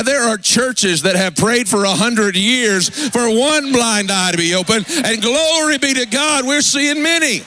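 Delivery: preacher rising in pitch toward the praise; impassioned preaching. Real, not acted. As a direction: pitch climbing high to a fervent peak. Preaching builds to a peak.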